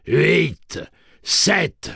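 loud, high single numbers; robot countdown. A narrator's reading. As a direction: call each number like a machine.

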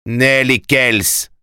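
high, drawn-out exclamation; crying out. A narrator's reading. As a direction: call both names out slowly. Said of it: "s'écria-t-il".

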